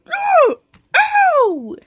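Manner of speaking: high yelp of pain; pretend cry of pain. Toy figure yelping when hit in the fight.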